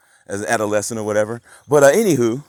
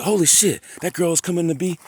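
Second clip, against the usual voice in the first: high, loud holy shit and whoa cool; boy learning the new girl lives nearby, excited; kid's excitement; 9 semitones higher, 5 dB louder than usual. Retold or imitated. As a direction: gush like a thrilled third grader. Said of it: "So I was like".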